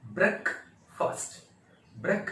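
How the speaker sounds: ब्रेकफास्ट split, short brek, then fast; slow correct pronunciation. Shown as a demonstration. Teacher drilling breakfast for learners, split-syllable demonstration. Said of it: "इसको बोलेंगे".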